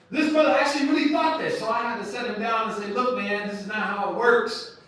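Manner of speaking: high and loud, in full preacher force; fiery preaching. Real, not acted. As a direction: drive it home to the crowd.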